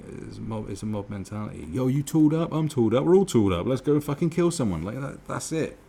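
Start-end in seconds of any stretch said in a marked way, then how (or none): 1.7-4.8 higher and louder, hyped up for a fight; rowdy thug urging the gang on. Retold or imitated. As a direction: rev up the crew for violence. Gang members voiced before killing.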